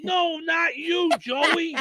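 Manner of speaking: high mock cry at the furry animal; hammed-up panicked officer; frantic cop. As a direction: wail it, then correct yourself. Mocking the cop yelling.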